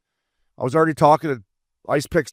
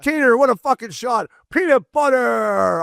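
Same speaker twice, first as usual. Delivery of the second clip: mock bench yell; 10 semitones higher, slower than usual. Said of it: "He's like".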